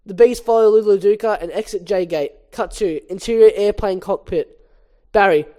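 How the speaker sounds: normal talking voice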